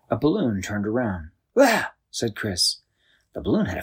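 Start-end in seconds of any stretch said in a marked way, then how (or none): 1.6-1.9 higher, louder yelp of fright; startled yelp. A narrator's reading. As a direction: jump back with a short cry. Balloon face turns to Chris.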